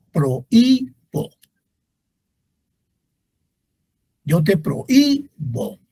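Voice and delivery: "prohíbo" slowly, pro-Í-bo; hiatus demo. Shown as a demonstration. Teacher showing a hiatus, slow syllable-by-syllable "prohíbo". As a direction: split O from stressed Í in "prohíbo". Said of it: "Ahí hay hiato".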